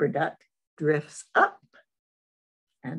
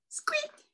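very high, soft squeaky toy sound; squeaky rubber duck; 7 dB quieter than usual. Acted. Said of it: "says the little rubber duck".

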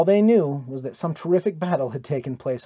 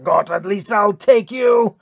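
higher, louder, slowed furious yell; raging shout; 6 semitones higher, 6 dB louder than usual. A narrator's reading. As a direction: roar it with your last breath of hate. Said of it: "heard Hastings' raging shout".

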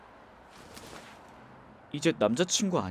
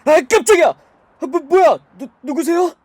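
jumps with a loud high yelp; shocked cry then stammering; startled; 12 semitones higher, 12 dB louder than usual. Acted. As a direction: caught off guard by a stranger in the car. Stranger suddenly in back seat.

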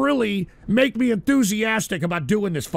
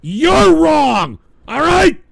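louder, higher, yelling at the chat; angry shout; 5 semitones higher, 7 dB louder, slower than usual. Real, not acted. Streamer telling his chat they are wrong, angry shout. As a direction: hurl it at everyone listening.

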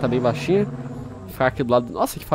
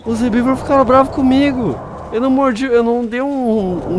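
flee in alarm; panicked; 9 semitones higher than usual. Real, not acted. Player chased by angry herbivores, panicking.

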